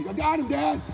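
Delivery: put-on high-pitched kid calling out; excited little boy. Boy in fight calls to his dad, excited.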